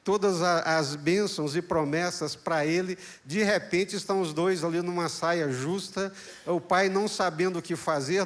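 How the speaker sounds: ordinary talk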